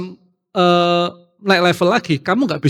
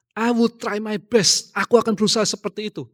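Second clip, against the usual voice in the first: earnest vow; 6 semitones higher than usual. Retold or imitated. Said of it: "di kata-kata itu".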